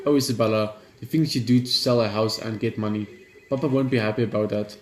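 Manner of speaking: ordinary talk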